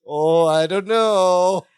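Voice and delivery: drawn-out unsure reply; slow hesitant. Retold or imitated. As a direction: stretch it out nervously. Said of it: "Larry's like".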